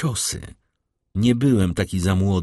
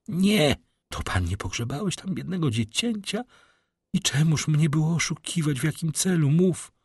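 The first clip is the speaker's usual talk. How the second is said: frightened plea; 8 semitones higher than usual. A narrator's reading. Baroness pleading, frightened.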